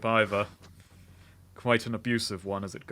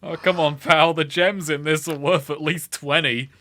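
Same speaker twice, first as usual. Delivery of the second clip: loud haggling; 5 semitones higher, 7 dB louder than usual. Acted. Haggling in character over gems.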